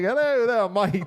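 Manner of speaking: high, hammed-up Aussie server greeting; imitating Australian accent. Retelling the Outback server's greeting, mock Australian accent. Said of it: "He was talking in the accent".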